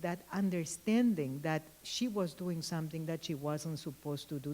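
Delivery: ordinary delivery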